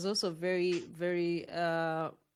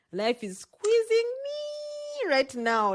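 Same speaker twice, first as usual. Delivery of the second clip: mock strain; 3 dB louder, slower than usual. Imagined strong friend's complaint.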